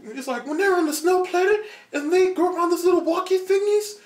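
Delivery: very high, loud giddy explaining; squeaky teen rambling; eager teen. Retold or imitated. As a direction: ramble like an excited kid. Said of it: "he's all like".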